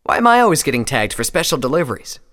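high, loud whine of complaint; protesting Scarlet. A narrator's reading. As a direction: object to being singled out. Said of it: "Scarlet protested".

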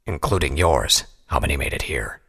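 low, near-whispered question; stern interrogator. A narrator's reading. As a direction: ask it low and cold.

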